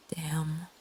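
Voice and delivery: ordinary delivery, nothing marked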